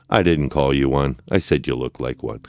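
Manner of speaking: ordinary delivery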